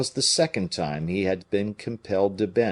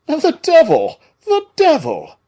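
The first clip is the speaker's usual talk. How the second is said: much higher, louder dismayed outcry; disappointed cry; 11 dB louder than usual. A narrator's reading. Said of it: "cried Porthos, much disappointed".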